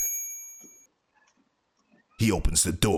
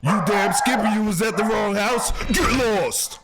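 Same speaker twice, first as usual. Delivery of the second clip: high-pitched indignant holler; mock angry shout; 11 semitones higher than usual. Host scolding a man at the wrong house, high mock-angry shout. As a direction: tell him off and send him packing. Scolding apologetic stranger in video.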